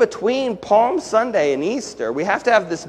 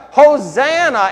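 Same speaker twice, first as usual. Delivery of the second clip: Hosanna called out higher, louder and slow; shouted Hosanna; 7 semitones higher, 6 dB louder, slower than usual. Retold or imitated. Pastor imitating the crowd's joyful shout, loud call. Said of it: "We shout".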